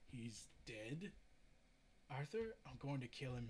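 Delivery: plain reading voice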